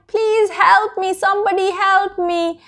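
a touch of panic, calling out; mild crying for help. Acted. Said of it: "she started crying".

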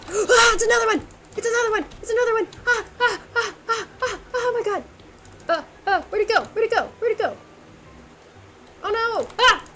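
panicked high screaming; scared horror game streamer. Real, not acted. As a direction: shriek in terror at the monster. Panicked fight with monster.

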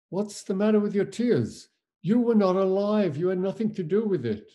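high-pitched, pleading objection; retold protest. Acted protest at tearful minister.

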